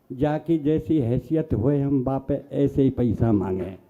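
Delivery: slow, low, humble beggar's plea; pleading beggar. Retold or imitated. Beggar humbly pleads with the poet, slow and low. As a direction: beg slowly with folded hands.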